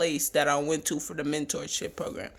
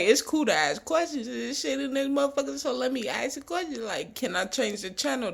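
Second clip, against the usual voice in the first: eager naive question; 5 semitones higher, 4 dB quieter, faster than usual. Retold or imitated. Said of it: "I'm like".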